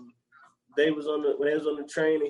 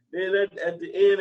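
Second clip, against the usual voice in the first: normal talking voice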